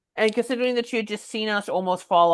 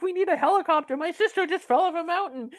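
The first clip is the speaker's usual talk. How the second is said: imitating a panicked sister, much higher, fast; freaking out; 9 semitones higher, faster than usual. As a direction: rattle it off in high-pitched panic. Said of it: "freaking out".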